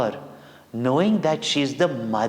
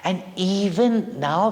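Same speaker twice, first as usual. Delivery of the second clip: usual speaking voice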